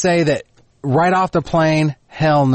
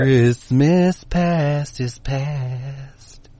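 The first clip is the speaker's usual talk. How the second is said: very slow, drawn-out spectral voice; ghostly Christmas voice; 2 semitones higher, much slower than usual. Pretend. Doing a Christmas ghost for the co-host: slow eerie moan.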